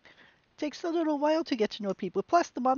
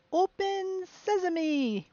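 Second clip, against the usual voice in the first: slow, drawn-out, high theatrical "Open sesame!"; hammy magic incantation; 7 semitones higher, much slower than usual. Pretend. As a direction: intone it like a stage wizard casting a spell. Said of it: "You leave me no choice".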